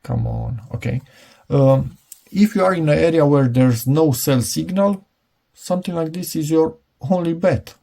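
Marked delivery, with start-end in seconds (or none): none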